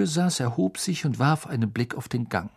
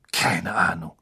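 "Keine Ahnung" whispered unvoiced and lower; 7 semitones lower than usual. A narrator's reading. Harry answering a question in a whisper, hushed. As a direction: breathe "Keine Ahnung" out on a hush.

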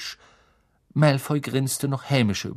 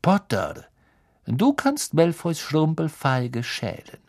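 hateful Snape; 4 semitones higher than usual. A narrator's reading. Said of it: "mit jenem hasserfüllten Blick".

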